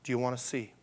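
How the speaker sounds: dropped to a soft, intimate ask; quiet echo of the question. Acted. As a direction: pull the room in close with it. Preacher repeats Jesus' question softly.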